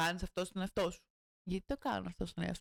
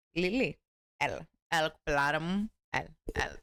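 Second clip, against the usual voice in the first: louder, coaxing call to a pet; 6 semitones lower, 4 dB louder than usual. Real, not acted. Coaxing Lili over to her, loud and sweet.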